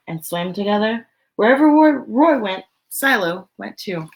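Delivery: lively, expressive storybook read; reading to children. Acted. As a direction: read it out to a room of little kids. Reads picture book aloud.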